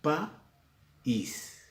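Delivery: "País" with a clear break, pa... ís; broken hiatus. Shown as a demonstration.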